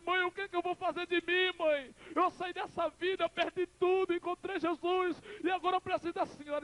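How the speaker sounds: very high, wailing entreaty; acted desperate plea. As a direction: cry out to a mother like a lost child. Son pleads with dying mother.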